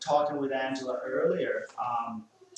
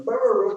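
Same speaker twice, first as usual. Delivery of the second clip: louder, higher mock growl-bark; imitated barking; 8 semitones higher, 8 dB louder, much faster than usual. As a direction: bark it out like a nervous dog. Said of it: "as soon as she goes from".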